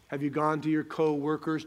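normal talking voice

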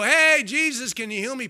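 woman calling Jesus. Retold or imitated. Woman in crowd calling to Jesus, mock plea. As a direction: call out to Jesus, half-acted.

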